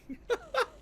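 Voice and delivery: high-pitched stuttering giggle; high laugh. Real, not acted. Laughing at getting worked up, high laugh. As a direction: break into high, rapid laughter. Laughs at his own rant.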